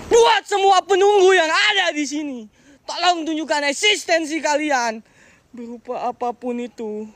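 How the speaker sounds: slow, high, ceremonial summons to spirits; solemn invocation. Real, not acted. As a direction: call out to unseen spirits.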